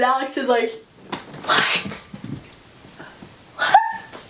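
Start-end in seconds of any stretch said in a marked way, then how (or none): 1.4-2.1 voiceless, breath-only "What?"; acted hushed disbelief; stunned whisper. Alex's stunned reaction, first take. 3.6-4.3 tiny, very high "What?"; acted high-pitched shock; shocked squeak. Alex's stunned reaction, second take.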